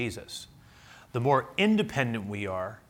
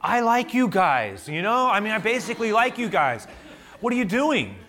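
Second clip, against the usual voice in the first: exasperated plea; 11 semitones higher, 5 dB louder than usual. Retold or imitated. Pastor pleading with the police officer, high and loud. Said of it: "I go".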